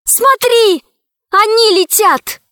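put-on high, loud girl's cry; shouting. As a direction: call out in alarm, high and loud. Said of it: "Алиса вдруг не закричала".

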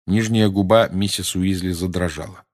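ordinary delivery

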